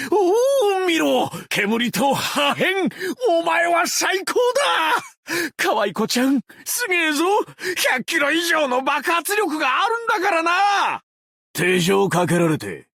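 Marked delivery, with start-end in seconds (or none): 0.1-11.0 thrilled shout. Acted. Thrilled by the explosion.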